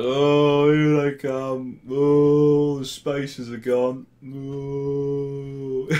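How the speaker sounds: high, drawn-out gloating wail; long loud "oh"s sliding into mock crowing; smashed festivalgoer. Smug drunk early bird watching everyone arrive, high drawn-out mock wail. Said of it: "you're already smashed. And he's like".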